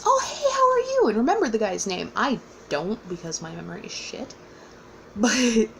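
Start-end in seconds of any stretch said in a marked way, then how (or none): 0.0-1.1 much higher, louder friendly hello; bright surprised welcome to a friend; cheery greeting. Retold or imitated. Author greeting the guy he remembers, much higher cheery hello.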